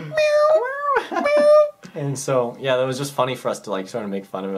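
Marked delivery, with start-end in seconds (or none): 0.1-1.7 very high cat meows, the second louder; mock meowing. Teens teasing the teacher as they walk by, high mock meows. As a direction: meow like a cat to tease him. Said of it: "we'd be like".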